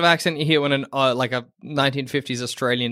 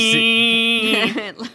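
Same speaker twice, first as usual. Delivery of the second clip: high, drawn-out bogan name call through a laugh; mock bogan voice; 7 semitones higher, 3 dB louder, much slower than usual. Joking with friends about bogan accents, high mock drawl.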